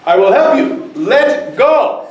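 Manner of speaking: high, emphatic pronouncement as God; God's voice. Acted. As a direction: proclaim it as God, then a punched command. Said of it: "And God says".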